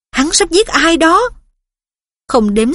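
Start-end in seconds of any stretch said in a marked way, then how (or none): 0.1-1.2 high urgent shout. A narrator's reading. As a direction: cry out in alarm. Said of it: "nó hét".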